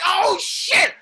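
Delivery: high, loud mock exclamation from the fans; loud, high "Oh, shit!" shout; excited shout. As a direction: yell it like a cheering crowd. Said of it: "I know you guys are like".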